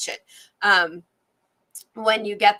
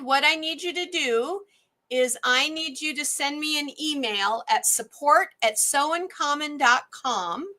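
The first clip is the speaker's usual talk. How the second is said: normal talking voice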